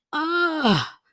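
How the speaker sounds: high, falling sigh of heartbreak; pained "ah". Real, not acted. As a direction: let out a high, sorry "ahh". Pained reaction, "that's heartbreaking.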